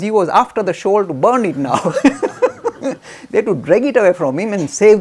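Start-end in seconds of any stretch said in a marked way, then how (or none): 1.8-3.2 high burst of laughter. Real, not acted. Storyteller laughing at the shawl story.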